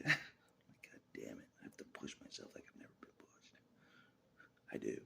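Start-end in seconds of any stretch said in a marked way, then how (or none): 0.9-3.5 soft unvoiced whisper. Real, not acted. Muttering to himself while straining, whispered. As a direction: breathe the curse and resolve.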